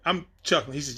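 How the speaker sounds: ordinary talk, nothing marked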